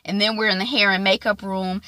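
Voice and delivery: ordinary delivery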